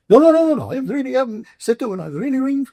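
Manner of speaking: put-on very high, loud-starting Indian accent; protesting Indian accent. Caller insisting he is genuine, high imitated Indian accent. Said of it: "worst Indian accent I've ever heard".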